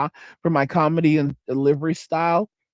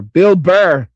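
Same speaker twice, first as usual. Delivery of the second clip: much louder, triumphant shout of the name; excited outburst; 9 dB louder than usual. Real, not acted. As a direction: blurt it out with relief, finally remembering. Chat finally names the comedian.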